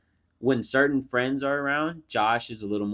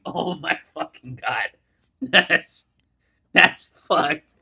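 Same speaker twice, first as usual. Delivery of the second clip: laughing disbelief; 4 semitones higher, 5 dB louder, much slower than usual. Retold or imitated. Own reaction, laughing.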